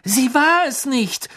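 high, slow, insistent defence; loud, drawn-out objection; emphatic protest. A narrator's reading. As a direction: speak up firmly for the accused, pitched high. Hermine defends the accused elf.

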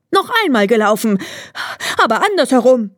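fast, high hare voice; hare demanding. A narrator's reading. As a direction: rattle it off, insisting on a rematch. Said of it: "rief er". (schnell und hoch fordernder Hase)

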